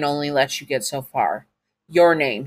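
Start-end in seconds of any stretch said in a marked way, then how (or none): none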